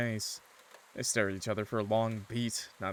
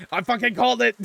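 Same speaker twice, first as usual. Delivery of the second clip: high, loud gloating yell; triumphant shout; 12 semitones higher, 12 dB louder than usual. Real, not acted. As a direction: shout it like you called it, thrilled. Streamer cheers at correct guess.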